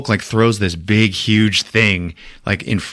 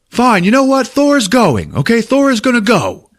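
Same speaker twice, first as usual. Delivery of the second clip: high, loud huffy retort; mock exasperation; 14 semitones higher, 4 dB louder than usual. Thor storming off from Hulk, hammed-up exasperation. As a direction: pitch it way up in a retold tantrum. Thor's side of retold quarrel.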